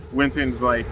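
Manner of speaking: ordinary talk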